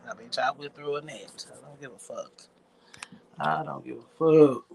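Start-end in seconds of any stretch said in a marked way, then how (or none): 1.5-2.3 much softer, half to himself; softly muttered "I don't give a fuck"; under breath. Real, not acted. Repeated line, first under breath. 3.4-4.6 louder, firm and defiant; "I don't give a fuck" said loud and flat-out; loud declaration. Real, not acted. Repeated line, now declared aloud.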